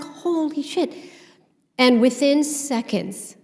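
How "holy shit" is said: high, hushed mock amazement; retold awe. Storyteller reliving the animals' arrival, awed. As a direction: lightly act out being stunned.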